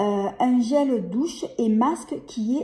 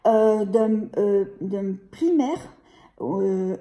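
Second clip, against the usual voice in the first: ordinary talk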